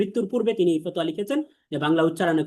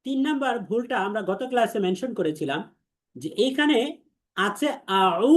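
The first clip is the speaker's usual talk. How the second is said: ordinary delivery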